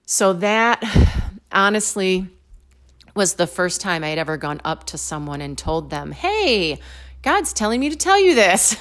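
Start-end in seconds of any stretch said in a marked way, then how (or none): 6.1-8.8 higher, louder mock proclamation; playful announcement. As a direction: announce it cheekily, pitch lifted. Playfully mimics approaching someone, then laughs.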